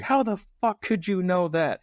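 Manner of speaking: stunned outrage. Retold or imitated. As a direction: blurt it like you can't believe him. Everyone stunned at Pitt.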